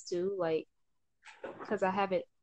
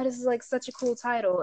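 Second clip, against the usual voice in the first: higher, louder retold delight; mildly excited; 5 semitones higher, 5 dB louder than usual. Podcaster recalling her delight over a new title, mildly excited. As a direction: gush lightly, as if quoting yourself.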